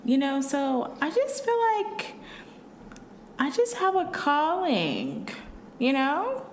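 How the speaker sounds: high, slow soccer mom voice; lightly put-on proper mom voice. Shown as a demonstration. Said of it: "what's yours, Britt".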